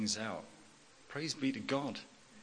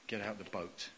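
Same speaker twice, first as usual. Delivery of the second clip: usual speaking voice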